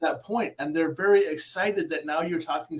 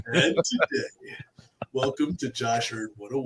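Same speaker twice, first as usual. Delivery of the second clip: mock narrator voice; 5 semitones lower than usual. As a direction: stretch it out, then sink low like a presenter. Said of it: "it's just that voice".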